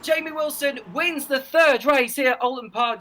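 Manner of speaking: excited. Real, not acted. Contact and finish call.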